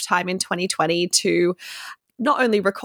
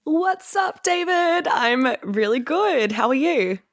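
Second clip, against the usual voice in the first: bright, high-pitched excited greeting; enthusiastic; 6 semitones higher, slower than usual. Real, not acted. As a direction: greet a friend with real excitement. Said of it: "your enthusiastic voice".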